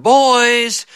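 mock dad's holler; imitated holler. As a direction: call out sharply on a high pitch. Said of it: "our daddy hollered".

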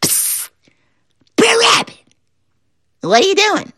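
very high little frog calling. Acted. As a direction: pipe it up small.

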